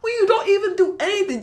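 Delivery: mad rant climbing to a high-pitched yell; angry yelling. Real, not acted. Speaker yelling at themself over inaction, angry. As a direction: yell at yourself, pitch rising sharply. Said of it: "I'm yelling at myself".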